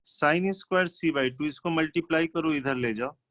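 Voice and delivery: ordinary talk, nothing marked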